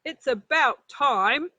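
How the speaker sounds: loud, slow, put-on dad voice; a dad who's been waiting ages; gruff father rabbit. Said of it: "said Father Rabbit".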